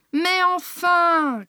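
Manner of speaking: high, drawn-out exclamation; exasperated cry. A narrator's reading. Phone correspondent losing patience with caller, crying out. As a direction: cry out, stretching the words in exasperation. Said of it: "cria ma correspondante".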